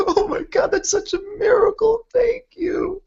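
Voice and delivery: very high, loud, tearful sobbing thanks; tearful joy. Acted. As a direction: cry with overwhelmed gratitude. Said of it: "Starts crying".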